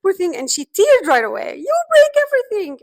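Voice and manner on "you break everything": put-on high, loud child's voice on the verge of tears; a hurt kid blaming mom; high, loud teary child's accusation; tearful child accusing.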